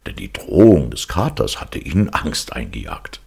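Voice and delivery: ordinary delivery, nothing marked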